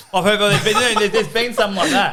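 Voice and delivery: laughing. Real, not acted. Laughing hint about draft question.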